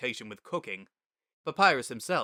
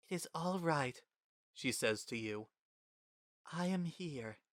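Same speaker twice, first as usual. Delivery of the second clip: higher, softer motherly comfort; Toriel comforting; 4 semitones higher, 7 dB quieter than usual. A narrator's reading. Toriel holding Frisk, soft higher tender comfort. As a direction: hush the child gently.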